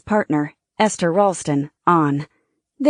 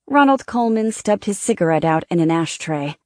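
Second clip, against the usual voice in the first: plain reading voice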